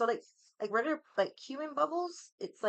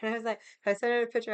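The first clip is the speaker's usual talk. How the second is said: normal talking voice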